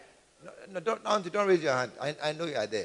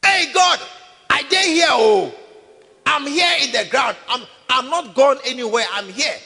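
calling out from the ground; 3 semitones higher, 7 dB louder than usual. Acted. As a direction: shout up from under the earth. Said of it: "it didn't keep quiet".

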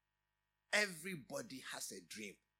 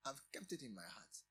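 softer, lower repeat of it in my heart; quiet echo; 5 semitones lower, 8 dB quieter than usual. Shown as a demonstration. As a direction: let it sink in softly.

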